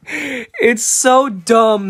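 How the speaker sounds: loud, slow, drawn-out amazement; amused exclamation. Real, not acted. Reacting to a silly nerd emoji, loud amused exclamation. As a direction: exclaim it loudly, stretching the words. Amused outburst, then repeated more calmly.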